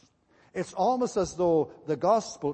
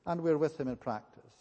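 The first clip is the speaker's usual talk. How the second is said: normal talking voice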